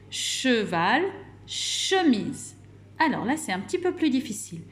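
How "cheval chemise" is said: "Cheval, chemise" slowly, each with a long ch; both words drawn out on the opening shhh; first syllable marked. Shown as a demonstration. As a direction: sound out the "che" starting "Cheval" and "chemise". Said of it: "par la syllabe".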